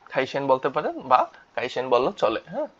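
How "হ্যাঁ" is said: ordinary talk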